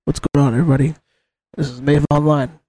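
a sultry after-dark host greeting; late-night stream voice. Shown as a demonstration. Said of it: "I start talking like this".

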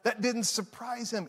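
ordinary talk, nothing marked

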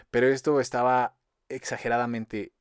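ordinary delivery

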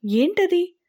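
high-pitched, eager query; curious question. A narrator's reading. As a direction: ask with lifted, curious voice. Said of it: "Radha కుతూహలంగా".

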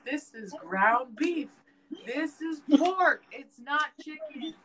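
loud, high, slow exasperated correction; irritated stepdad. Retold or imitated. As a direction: spell it out to a stubborn kid, fed up. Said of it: "he just started getting irritated".

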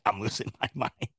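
soft, low words dissolving into laughter; laughing to tears. Real, not acted. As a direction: laugh as if close to crying. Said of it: "I think I'm crying".

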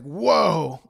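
loud, taken-aback whoa; mock shocked exclamation; startled whoa. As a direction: react as if hit with too much.

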